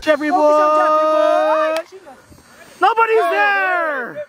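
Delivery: high, slow, stretched-out cry; excited calling out. Real, not acted. Commentator crying out that nobody is covering, excited. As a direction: stretch it out over the field. Calling out during live play.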